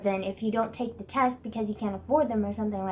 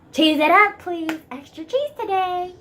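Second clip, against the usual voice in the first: louder, higher mock announcer cheer; cheesy hype voice; 7 semitones higher, 6 dB louder than usual. Vlogger hamming it up at her own cheesiness, mock hype. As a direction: ham it up like a cheesy ad. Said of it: "God, I sound terrible".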